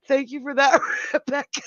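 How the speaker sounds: high, giggling through the words; laughing thanks. Real, not acted. Streamer thanking a viewer through tears of laughter. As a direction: thank her while still cracking up. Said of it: "I've got tears coming out of my eyes".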